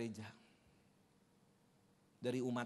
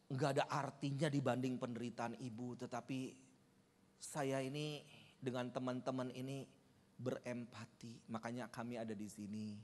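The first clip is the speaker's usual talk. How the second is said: tender; 6 semitones lower, 9 dB quieter than usual. Real, not acted. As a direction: speak gently to console her. Consoling the grieving widow.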